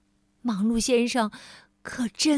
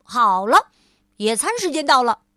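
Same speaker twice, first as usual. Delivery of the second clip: much louder and fast; brisk announcement; 7 dB louder than usual. Acted. Mr. Busy announcing picnic time, loud and fast. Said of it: "忙碌先生说".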